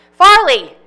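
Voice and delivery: loud, high call of Farley. Acted. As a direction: yell his name after him. Pauline calls after him.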